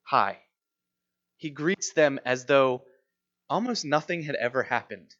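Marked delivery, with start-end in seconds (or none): 0.0-0.4 low, offhand hi; casual hi. Retold or imitated. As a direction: drop it as if nothing happened. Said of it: "Jesus just casually says".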